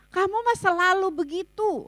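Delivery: acted spousal put-down; belittling complaint. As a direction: put your partner down in exasperation. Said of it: "kita merendahkan pasangan kita".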